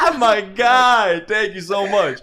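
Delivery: high, breathless laugh through words. Real, not acted. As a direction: still cracking up, slow. After the tickle laugh.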